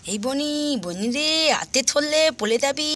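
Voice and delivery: put-on little child calling a playmate; small child's cautioning voice. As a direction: play a toddler warning a friend.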